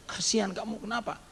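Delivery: soft, hushed, comforting; tender pity. Retold or imitated. Adults pity the fallen boy, softly. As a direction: coo over the hurt child quietly.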